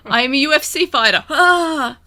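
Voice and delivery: hammed-up fighting bravado; mock fighter. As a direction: play a cocky fighter with a shouted attack cry. Mock fighter announcing skills while attacking.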